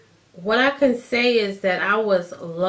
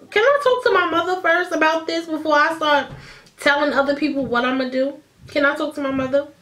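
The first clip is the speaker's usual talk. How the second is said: higher-pitched, exasperated retort; exasperated plea; 6 semitones higher, faster than usual. Retold or imitated. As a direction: act out an overwhelmed plea, starting high. Acted reply to aunt's question.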